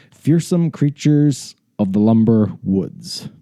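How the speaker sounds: slow, drawn-out title reveal; grand title announcement. Shown as a demonstration. Host presents the book's title to listeners, slowly and grandly. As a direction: announce it slowly, stretching each word.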